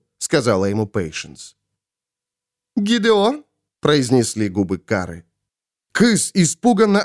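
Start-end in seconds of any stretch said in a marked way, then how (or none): 2.8-3.4 high, rising call; high-pitched woman's voice; questioning Svol. Acted. Possessed Kara's lips call Gideon.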